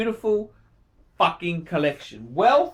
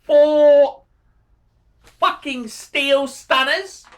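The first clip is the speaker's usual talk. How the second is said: big, drawn-out, higher and louder on four; emphatic rave; 7 semitones higher, 6 dB louder, much slower than usual. Real, not acted. Praising a viewer's four watches, slow emphatic rave. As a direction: savour each word like a prize.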